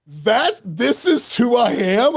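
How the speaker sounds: high, slow wounded question; mock-hurt high question; offended disbelief. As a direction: sound personally insulted. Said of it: "I'm like".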